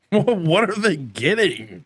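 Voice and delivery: incredulous. Real, not acted. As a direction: ask it slowly, stunned by the price. Stunned by the car price.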